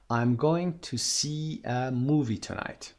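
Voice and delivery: each word slow and clear; slow model sentence. Shown as a demonstration. Teacher reading the example sentence slowly to the class. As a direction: read it slowly for learners. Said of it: "به عنوان مثال".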